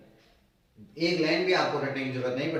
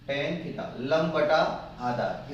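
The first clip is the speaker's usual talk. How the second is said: tan theta लंब बटा आधार stretched out very slowly; slow recitation; much slower than usual. Shown as a demonstration. Teacher shows how to read tan theta, very slowly. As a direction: spell it out slowly for the class. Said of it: "इसको ऐसे पढ़ेंगे".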